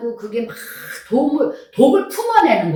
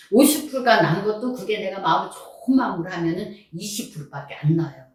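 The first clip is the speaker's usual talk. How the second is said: ordinary talk, nothing marked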